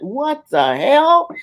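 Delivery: high, slow stunned what the hell; shocked kids. Retold or imitated. Children turning to parents at the television, stunned and slow. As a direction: drag it out in disbelief. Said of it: "our children turned to us and went".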